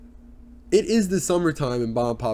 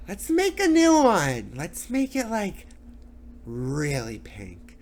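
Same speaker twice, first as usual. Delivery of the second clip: high, drawn-out vocal caricature of a reality star; mock a vapid celebrity, dragging the words; insufferable reality star; 7 semitones higher, slower than usual. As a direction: start high and stretched, drop to a slow drawl. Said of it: "she's just like".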